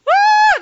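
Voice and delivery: high, loud frightened scream; scream of fear. Retold or imitated. Disciples crying out in fear at the ghost, loud high scream. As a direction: let out a startled scream. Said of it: "they're crying out in fear".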